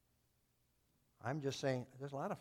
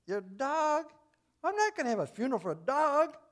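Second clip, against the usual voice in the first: higher and louder, indignant pastor; offended preacher; 12 semitones higher, 7 dB louder than usual. Retold or imitated. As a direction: scoff at the idea of burying a dog. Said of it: "the preacher was kind of offended".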